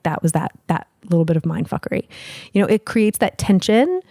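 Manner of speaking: ordinary talk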